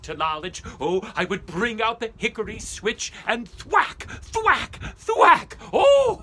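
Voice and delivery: high, loud gloating over the thrashing; cackling schoolmaster at his switch; gleeful martinet. Acted. Strict schoolmaster thrashing boys.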